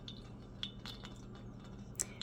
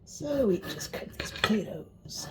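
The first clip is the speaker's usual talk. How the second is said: whisper; 10 dB quieter than usual. Real, not acted. Quiet aside while cutting.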